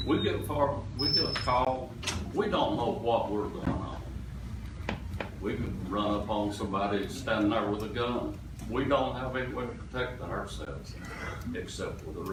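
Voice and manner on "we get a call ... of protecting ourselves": normal talking voice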